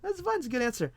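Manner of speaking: squeaky mock approval; mock high reassurance. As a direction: put on a falsetto to play it nice. I was like.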